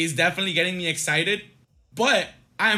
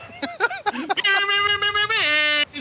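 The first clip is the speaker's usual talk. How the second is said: high laugh; 11 semitones higher than usual. Real, not acted. Laughing after goal call.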